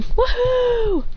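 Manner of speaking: high-pitched acted cheer; excited whoop. Character heads off to explore, high excited whoop.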